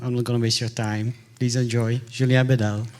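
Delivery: ordinary talk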